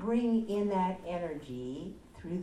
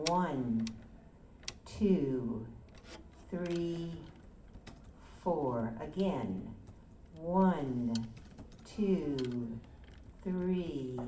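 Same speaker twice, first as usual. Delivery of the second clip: soft spa whisper; 2 semitones lower, 3 dB quieter, slower than usual. Acted. Leads a counted breathing exercise.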